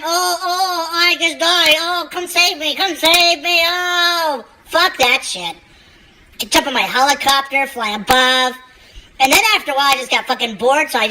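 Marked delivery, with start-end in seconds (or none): 0.1-5.6 high, whiny mock girlfriend; mock girlfriend whining in a high voice; mock whiny girl. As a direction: whine like a helpless gamer girl. Mimicking girlfriend dying in game.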